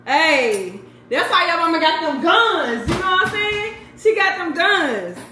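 high, loud, victorious bragging; triumphant boast. Real, not acted. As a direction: crow about finally opening it. Mama boasts about her strength.

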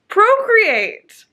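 procreate punched high and loud; stress on procreate. Real, not acted. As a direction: hit procreate hard to make the point. Stressing biology argument.

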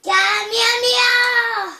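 long drawn-out attack yell; Kamehameha shout. Pretend. Kid shouting Dragon Ball attack.

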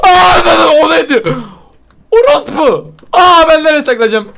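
very high, frantic shrieks; panicked screaming. Real, not acted. Player spotted and needing a hiding place, panicked screaming. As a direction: cry out in fright, scrambling to hide. Startled, looking to hide.

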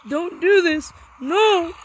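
alarmed protest. Real, not acted. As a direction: protest loudly as the attack closes in. Pleading as game mobs attack.

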